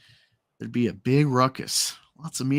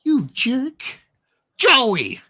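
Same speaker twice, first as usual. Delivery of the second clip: angry shout; 10 semitones higher, 5 dB louder, much slower than usual. Acted. Acted comic scene, scolding.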